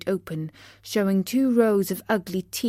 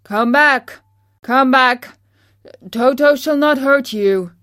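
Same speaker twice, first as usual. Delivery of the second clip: loud, raised call to runaways; 5 semitones higher, 9 dB louder than usual. A narrator's reading. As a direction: call them back loudly in short bursts. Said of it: "while he called to the mice".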